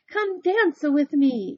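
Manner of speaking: high, loud, slow plea to dance; ladies crying out. Acted. Village ladies begging Big Anthony to dance, loud high cry. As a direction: call him onto the floor, loud and high. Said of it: "each of them cried".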